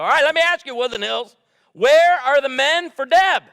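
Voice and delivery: loud, high call to the whole congregation; rally the room with a shout. Real, not acted. Pastor calls out to the congregation, loud and high.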